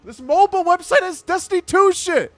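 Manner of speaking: high-pitched, full-volume complaint; outraged yelling. Real, not acted. Streamer explaining to a friend why he's upset, outraged yelling. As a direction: holler in appalled disbelief.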